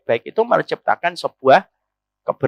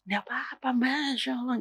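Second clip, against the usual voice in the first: soft gentle wife; 3 semitones higher, 13 dB quieter than usual. Acted. Wife answers softly.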